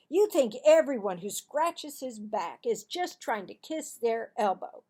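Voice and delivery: loud protest; defensive boy. Acted. Marvin to Cassie, loud defensive denial. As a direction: deny it hotly.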